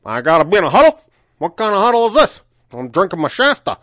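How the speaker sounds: loud, high put-on character voice; Mike Belowich. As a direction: play an affronted oddball at full volume. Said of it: "Belowich talked just like this".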